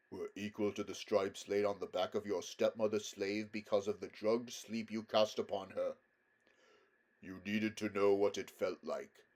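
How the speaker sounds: majestic lion, stern. A narrator's reading. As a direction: pronounce judgement with grave weight. Aslan passes judgement on Aravis.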